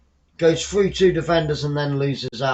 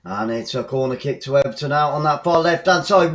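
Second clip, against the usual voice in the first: rising, louder live match commentary; play-by-play commentary; 3 semitones higher, 4 dB louder than usual. Acted. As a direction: call the attack as it happens.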